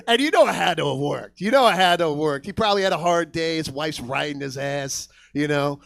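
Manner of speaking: ordinary talk, nothing marked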